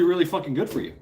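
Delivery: normal talking voice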